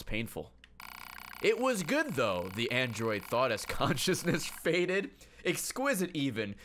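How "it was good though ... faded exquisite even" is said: raised, dramatic narrator voice; story narration. Acted. Reads game narration aloud.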